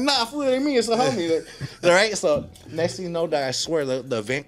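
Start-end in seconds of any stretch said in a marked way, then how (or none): 0.0-1.5 high-pitched, louder mock protest; hammed-up squeaky brush-off; acted evasive denial. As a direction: play trying to pass as somebody else. Acts out hiding from fans.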